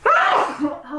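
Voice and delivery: a sudden, sharp, real sneeze. Real, not acted. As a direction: let a big sneeze burst out. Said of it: "And I'm going to sneeze".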